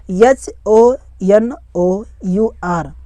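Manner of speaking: very slow, separated letters; one letter at a time; spelling it out. Shown as a demonstration. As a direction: spell it slowly for learners. Spelling a word aloud for learners.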